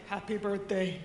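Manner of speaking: imitating a grumpy border guard; mock-grudging birthday greeting in a put-on voice; grumpy birthday wish. Security guy to birthday traveller, comically grumpy. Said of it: "The grumpiest birthday wish ever".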